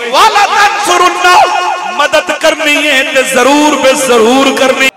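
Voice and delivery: loud, rousing sermon delivery with stretched climaxes; impassioned preacher in full cry; full-force preaching. Real, not acted. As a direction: preach it to the whole crowd, loud and driving.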